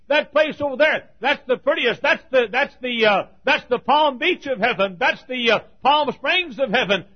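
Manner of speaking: loud and high, rushing, then a ringing question; preaching at full force. Acted. Preacher to his congregation, asking about the ringed men, preaching loudly. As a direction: cry it out over the crowd. Preacher describes the prettiest place.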